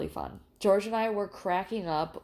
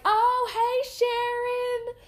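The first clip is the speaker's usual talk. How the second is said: imitating a gushing small-town friend, much higher; high gushing greeting; 5 dB louder, much slower than usual. Diner regulars greeting each other, high gushing voice. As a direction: squeal the hello like a chatty neighbor. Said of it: "And they were like".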